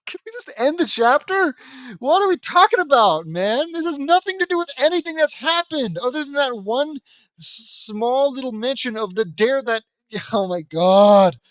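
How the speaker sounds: loud, high-pitched rant; exasperated outburst. Real, not acted. Reader fed up with pointless chapter, exasperated outburst.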